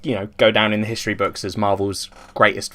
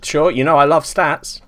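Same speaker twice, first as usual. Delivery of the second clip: slow, higher sarcastic agreement; 5 semitones higher than usual. Real, not acted. Co-host answering a request for stats, sarcastic. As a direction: feign a love of stats, drawing it out. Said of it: "That was sarcasm".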